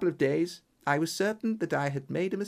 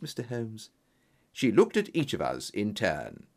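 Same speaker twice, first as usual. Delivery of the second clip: ordinary delivery, nothing marked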